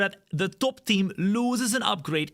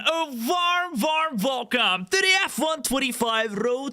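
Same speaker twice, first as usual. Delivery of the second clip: big hype intro, drawn out; announcer voice; 6 semitones higher, slower than usual. Acted. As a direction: boom it out like a ring announcer. Announcer-style opening.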